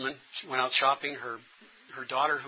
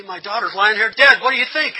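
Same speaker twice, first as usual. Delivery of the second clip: shouted, much higher and louder; retold angry outburst; 8 semitones higher, 16 dB louder than usual. Grieving mother to the officer, an anguished angry shout. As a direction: hurl it back in grief and fury. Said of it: "she looked at me and she said".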